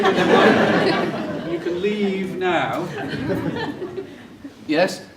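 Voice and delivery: slow words broken by laughter; laughing. Real, not acted. Lecturer joking with adults in audience, laughing. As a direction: chuckle through the joke as you say it. Jokes about adults with goggles.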